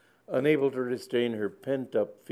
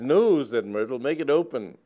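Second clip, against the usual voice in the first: firm; 4 semitones higher, 8 dB louder than usual. Acted. Myrtle insists firmly.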